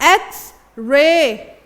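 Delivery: एक्स रे very slow, eks spelt out clearly; slow model word. Shown as a demonstration. To students, a teacher slowly spells out एक्स रे. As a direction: draw out एक्स before रे. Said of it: "इसमें आ रहा है sound आई का".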